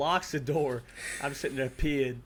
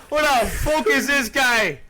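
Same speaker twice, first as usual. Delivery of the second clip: incredulous repeat; 6 semitones higher, 6 dB louder, slower than usual. Real, not acted.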